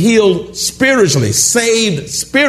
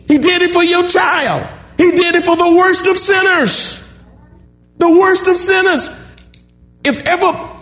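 much higher, ringing preacher's cry; exultant preaching; 10 semitones higher than usual. Acted. Preacher exulting to the church that Jesus did it for them, soaring full-force preaching. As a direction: lift it high and proclaim it with joy.

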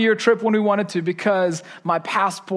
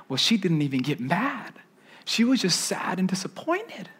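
ordinary talk

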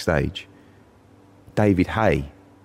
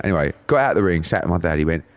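normal talking voice

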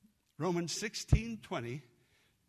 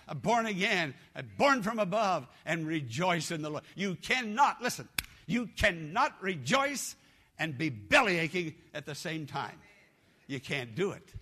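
louder, rising preacher's exhortation; full-voiced sermon climax; fiery preaching; 5 dB louder than usual. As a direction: rouse the church to rejoice.

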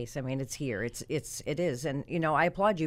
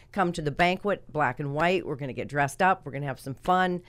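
ordinary talk

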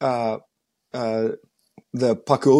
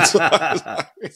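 laughing; 4 dB louder, slower than usual. Real, not acted. Laughing at own story.

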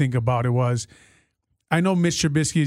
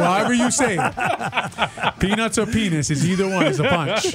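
laughing; 7 semitones higher than usual. Real, not acted. Joking about the word, laughing.

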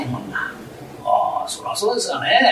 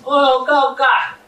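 calling out loud; 7 semitones higher, 5 dB louder than usual. Acted. Husband calling out to wife.

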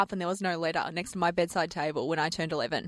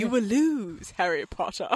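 cold rasping evil emperor; slower than usual. Acted. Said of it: "not a bad impersonation by you".

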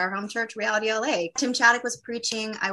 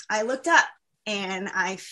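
usual speaking voice

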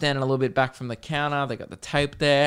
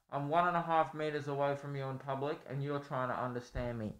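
very quiet, muffled by a mask from afar; hard-to-hear masked voice at a distance; distant and muffled; 13 dB quieter than usual. Shown as a demonstration. Showing how he sounds to people in public, muffled and distant. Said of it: "This is how I sound".

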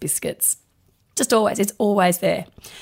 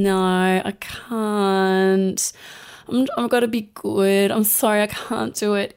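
apologetic refusal. Shown as a demonstration. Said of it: "That is really disempowering".

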